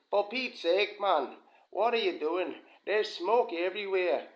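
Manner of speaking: attempted Scottish accent. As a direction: play the Scottish distillery manager yelling about the smoke. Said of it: "I was trying to do a Scottish accent".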